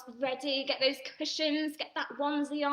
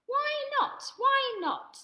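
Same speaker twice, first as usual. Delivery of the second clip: put-on squeaky little Gruffalo child, pitched way up; high child voice; 13 semitones higher, 4 dB louder, slower than usual. Little Gruffalo child pleading with Dad, high and eager. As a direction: ask like an excited little monster child.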